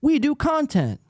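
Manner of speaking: sneering impression of a smug host; mocking Gino. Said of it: "When Gino and all these guys".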